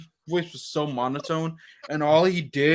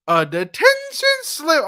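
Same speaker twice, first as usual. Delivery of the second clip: a slow, much higher, louder mock teacher threat; aggressive teacher threat; 12 semitones higher, 6 dB louder, slower than usual. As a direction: drag out the threat like a strict teacher gloating. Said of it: "that aggressive tone that she".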